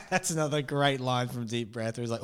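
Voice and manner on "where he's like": normal talking voice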